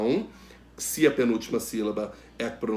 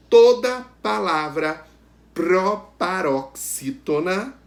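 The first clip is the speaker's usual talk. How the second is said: slow, loud, higher, "toda palavra proparoxítona" chunked out; emphatic teacher underlining "toda palavra proparoxítona"; stressing the rule; 4 semitones higher, 6 dB louder, slower than usual. Shown as a demonstration. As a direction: hammer "toda", then sound out "proparoxítona" slowly. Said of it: "que eu gostaria de frisar com vocês".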